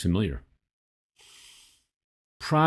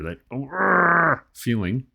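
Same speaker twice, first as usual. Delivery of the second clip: long, exasperated grr of pent-up anger; frustrated growl; 3 semitones higher, much slower than usual. Acted. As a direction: growl through it, drawn way out. Acting out the anger felt in body.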